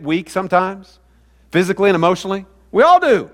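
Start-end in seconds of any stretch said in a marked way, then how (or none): 2.7-3.3 emphatic. Real, not acted. Answering his own question.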